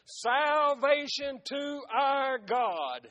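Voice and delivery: drawn-out, slow proclamation; loud cry. A narrator's reading. Multitude crying before the throne, slow proclaimed cry. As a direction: stretch each word out as a preached cry. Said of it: "cried with a loud voice".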